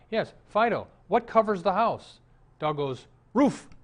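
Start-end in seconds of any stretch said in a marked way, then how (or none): 0.1-2.2 higher, louder prompting of a dog; eager dog owner. Acted. Man showing off his talking dog to theater manager, eager. 3.3-3.7 high, loud one-word bark; barking dog. Acted. Dog answering its owner's question, barked.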